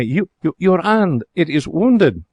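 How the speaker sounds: high, dismayed cry. Acted. Lady sees the cashier's bitten hand, dismayed. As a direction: gasp it out in alarm. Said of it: "a cry of dismay".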